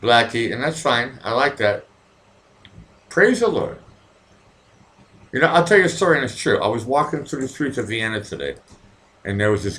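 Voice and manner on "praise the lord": mock preacher. Joking bishop praising the end of the week, hammed-up preacher. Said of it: "call me Bishop Blackie".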